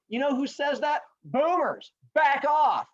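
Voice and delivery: high-pitched scornful brush-off, punching the last words; acting out cocky kids telling off an elder; mock defiant teens. Kids tell the old man to back off, mock defiant.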